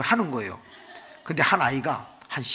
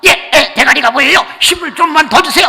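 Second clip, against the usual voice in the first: high, loud urging shout; urgent delivery-room doctor; 12 semitones higher, 15 dB louder than usual. Acted. Doctor to mother in labour, loud urgent shouting. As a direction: play a doctor yelling to push. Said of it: "할 때 의사가".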